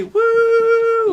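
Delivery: long, high, loud woo; high party cheer; celebratory whoop. Real, not acted. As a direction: whoop it up for the birthday. Cheering own birthday.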